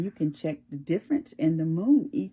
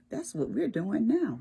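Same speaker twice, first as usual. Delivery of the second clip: usual speaking voice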